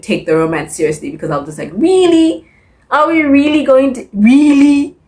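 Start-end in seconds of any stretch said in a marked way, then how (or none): 1.7-5.0 high, loud disbelieving protest; hammed-up exasperated disbelief, pitched way up; incredulous. Reader doubting the story.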